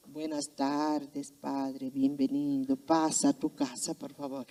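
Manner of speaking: mock a cold, formal greeting; stiff formality.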